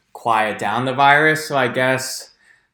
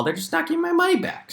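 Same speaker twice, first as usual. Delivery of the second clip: whiny complaint; faster than usual. Acted. Whiny complaint about refund.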